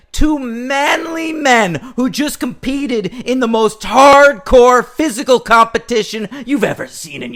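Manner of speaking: high, loud, building rant; emphatic hype. Real, not acted. Arguing how manly the two fighters are, loud and emphatic. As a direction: punch it up with disbelief. Hyping the manliness for effect.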